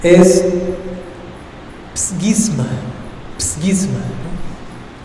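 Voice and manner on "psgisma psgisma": psgisma 또박또박 발음 시범; psgisma를 그리스어처럼 천천히 발음; Greek word. Shown as a demonstration. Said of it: "El término griego es difícil de pronunciar".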